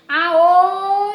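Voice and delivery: high, loud yell; shout. Acted. Teacher showing the mole's shout, loud. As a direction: belt out the cry. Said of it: "Gritava aô".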